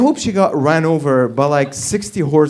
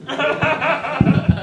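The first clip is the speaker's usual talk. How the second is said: high laugh; 12 semitones higher than usual. Real, not acted. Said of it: "What the fuck is wrong with newscasters".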